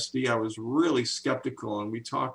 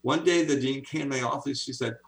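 normal talking voice

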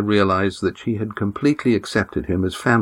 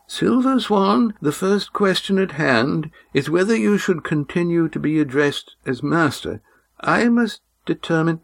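put-on formal old man; 10 semitones higher than usual. A narrator's reading. Old Oron welcomes the Silver Swan, formal. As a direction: greet her like a stiff elder.

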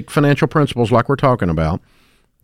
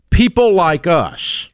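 drawn-out, higher quoted refrain; common excuse; 5 semitones higher, much slower than usual. Retold or imitated. Host quotes listeners' usual excuse, slow mock lament. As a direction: stretch it out slowly like a familiar complaint. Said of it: "heard or even said these words".

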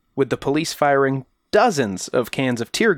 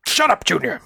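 Sean Connery voice; 5 semitones higher than usual. Retold or imitated. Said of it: "Sean Connery?".

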